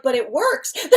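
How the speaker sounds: giggling through the guide's reply; laughing. Retold or imitated. Laughing while quoting the guide's excuse. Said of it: "they're like".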